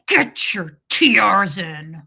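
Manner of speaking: imitating dad's loud, slow order through gritted teeth; menacing dad, teeth clenched; talking through teeth. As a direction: force it out loudly and slowly through clenched teeth. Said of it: "He talked through his teeth".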